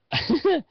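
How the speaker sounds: brief laugh; quick giggle; short high laugh. Real, not acted. Laughs after yawning.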